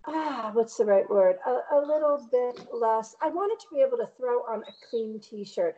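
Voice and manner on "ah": ordinary delivery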